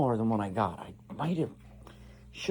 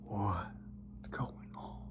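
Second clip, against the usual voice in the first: whisper; 6 semitones lower, 17 dB quieter, much slower than usual. Real, not acted.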